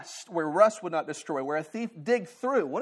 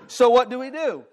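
ordinary talk

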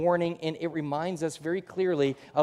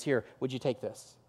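softer and lower, slow; tender Hosea; 5 semitones lower, 6 dB quieter than usual. Retold or imitated. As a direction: offer it gently, humbly. Hosea gently hands over goods.

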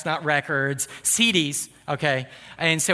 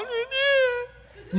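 put-on little girl. Daughter admitting to dad she did wrong, high childish voice. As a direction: answer like a sulky small child.

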